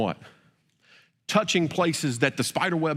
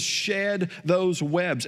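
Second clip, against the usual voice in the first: slow emphatic; 4 semitones higher, much slower than usual. Acted.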